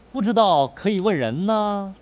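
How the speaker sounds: ordinary talk, nothing marked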